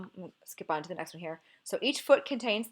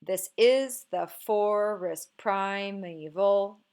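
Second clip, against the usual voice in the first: slow, louder beat on IS, FOR in forest and PRI in primeval; plodding da-DUM scansion of "This is the forest primeval"; forced iambic stress; 5 dB louder, much slower than usual. Shown as a demonstration. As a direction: force every second syllable, this IS the FORest PRImeVAL. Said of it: "let's try to make it iambic".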